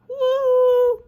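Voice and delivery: high, loud cheer; a celebratory squeal of joy; excited whoop. Real, not acted. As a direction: let out a happy woo. Cheering after finishing the live.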